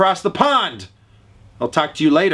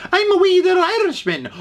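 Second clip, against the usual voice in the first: much higher, hammed-up Irish lilt; mock Irish accent; 14 semitones higher, faster than usual. Getting into the mood for an accent, hammed-up Irish voice. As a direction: play a tiny jolly Irishman, cartoonishly high. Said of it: "I normally have to get into the mood, like".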